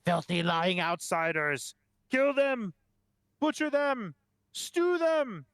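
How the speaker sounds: very high, frenzied yells in bursts; mob yelling. Acted. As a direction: cry for blood like a caged mob. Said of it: "yelling, like".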